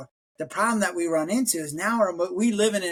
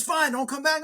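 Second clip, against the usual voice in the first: mock curt boss; 9 semitones higher than usual. Boss snaps back in retold fight.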